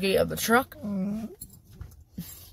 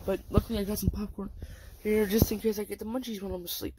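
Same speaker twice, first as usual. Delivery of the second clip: ordinary talk, nothing marked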